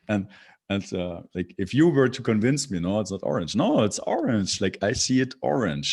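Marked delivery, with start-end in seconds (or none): 3.6-5.9 higher-pitched mock-stubborn insistence; playfully adamant, pitch jumping up; insistent contradiction. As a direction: push back as if it were obvious. Acted insistent reply to imagined objector.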